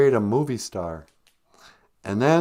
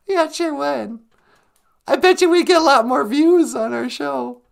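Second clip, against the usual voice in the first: much higher, louder silly puppet voice; 7 dB louder than usual. Acted. Puppet asking the host one more question, high silly voice. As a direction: squeak it out like a cheeky puppet.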